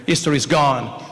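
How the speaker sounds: normal talking voice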